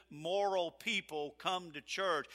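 ordinary talk, nothing marked

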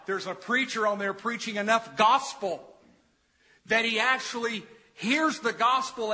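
high, emphatic storytelling from the pulpit; forceful preaching. Real, not acted. Preacher tells of Ed hearing the gospel, impassioned forceful preaching. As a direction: build to a loud, triumphant declaration.

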